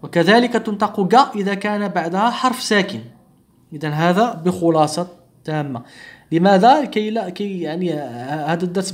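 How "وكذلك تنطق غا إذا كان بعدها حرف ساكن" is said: normal talking voice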